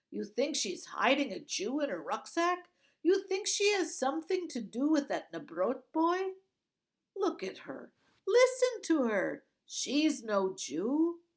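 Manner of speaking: loud, high sarcastic retort; scoffing guard. A narrator's reading. Bearded guard mocks the other, loud sarcasm. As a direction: throw it back sarcastically.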